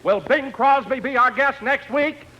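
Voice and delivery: normal talking voice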